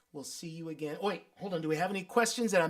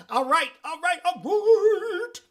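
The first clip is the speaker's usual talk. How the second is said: high, loud all rights; rising, pumped-up all rights; excited; 9 semitones higher, 5 dB louder, slower than usual. Real, not acted. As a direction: build up the hype.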